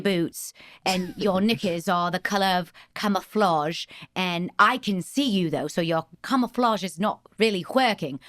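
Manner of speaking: put-on English accent, lads' banter. Shown as a demonstration. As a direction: needle a mate in a mock English accent. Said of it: "a prompt phrase to get into it".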